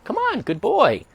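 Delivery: high, slow coaxing to the dog; drawn-out high praise; coaxing call. Real, not acted. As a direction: draw the dog along gently.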